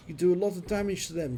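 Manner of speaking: ordinary talk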